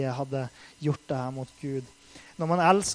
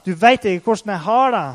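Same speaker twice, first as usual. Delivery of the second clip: louder, higher, shouting in anger; raised voice, reliving an angry prayer; retold shout at God; 6 semitones higher, 11 dB louder than usual. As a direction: cry out bitterly at God. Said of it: "eller jeg ropte fysisk da".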